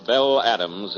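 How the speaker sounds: usual speaking voice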